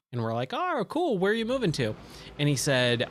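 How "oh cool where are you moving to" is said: high-pitched friendly reaction; upbeat curiosity. Retold or imitated. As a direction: mimic friends perking up at news. Friends' acted reply to his news.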